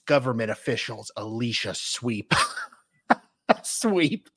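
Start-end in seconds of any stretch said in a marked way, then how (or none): 3.0-4.3 laughing. Real, not acted. Laughing at the name.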